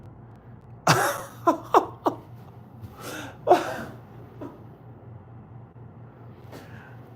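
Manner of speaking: 2 long, airy, high laugh of disbelief; 3 laugh like you can't believe what you just heard; 1 disbelieving laugh. Real, not acted. 4 hearing the judges call a draw, high airy laugh of disbelief.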